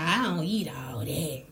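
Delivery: hushed imitation of the picky guest; low, secretive whisper of a complaint; mock whisper. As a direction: mimic her under your breath. Mimicking the guest at dinner.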